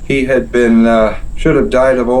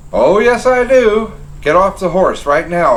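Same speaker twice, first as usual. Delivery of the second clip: fierce insistence; 7 semitones higher than usual. Acted. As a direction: make him obey now. Sergeant threatening the officer.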